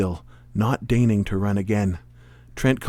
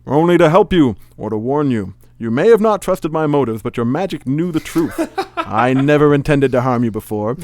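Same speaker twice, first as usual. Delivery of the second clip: grand explaining villain; 4 semitones higher, 6 dB louder than usual. Acted. Magician grandly explains himself to rival.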